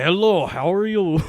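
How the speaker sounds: imitating a Russian woman's accent; Russian-accented greeting; thick Russian accent. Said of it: "she has, like, a thick Russian accent".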